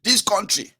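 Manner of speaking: high, sighing mock lament; mock complainer. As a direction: mimic a weary complainer. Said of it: "and say".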